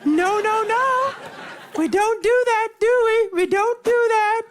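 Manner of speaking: high, loud sing-song princess scolding. Retold or imitated.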